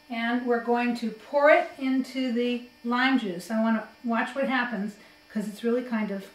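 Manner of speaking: ordinary delivery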